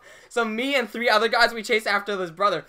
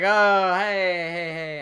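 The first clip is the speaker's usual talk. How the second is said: lightly voiced drunk woman; a hint of a drawn-out tipsy hello; mock drunken greeting; drunk lady greeting; 3 dB louder, slower than usual.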